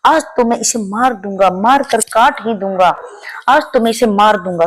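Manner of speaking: ordinary delivery, nothing marked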